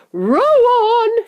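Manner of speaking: high, drawn-out rallying shout; shouted command. A narrator's reading. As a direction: yell to the rowers to press on. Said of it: "shouted Mister Wonka".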